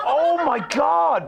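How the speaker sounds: high, slow, laughing gasp; mock horror through laughter; laughing dread. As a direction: realise the damage, laughing. Remembering drunken offensiveness.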